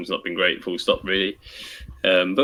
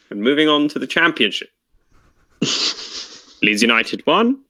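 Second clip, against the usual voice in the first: football results announcer; 4 semitones higher than usual. Retold or imitated. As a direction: move formally through the results. Continues the results-reader act.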